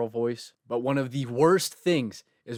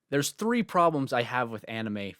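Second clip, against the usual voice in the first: normal talking voice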